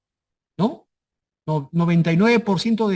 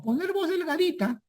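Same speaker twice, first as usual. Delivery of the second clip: high, soft thin voice for a moment; thin little voice; 10 semitones higher, 6 dB quieter than usual. Shown as a demonstration. Teacher demonstrating a thin little voice to students. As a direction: squeeze the voice up thin and small. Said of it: "la capacidad de modificar la entonación".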